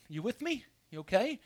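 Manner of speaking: ordinary talk, nothing marked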